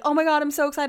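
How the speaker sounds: high-pitched gushing reaction; put-on thrilled reply; imitated excitement. Retells friend's excited reply.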